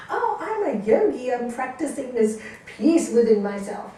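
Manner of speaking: lightly voiced self-satisfied student; mock smug yogi. Teacher mocking a student's spiritual boast, lightly. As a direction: poke fun at the proud yogi. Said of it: "Didn't you just say five minutes ago".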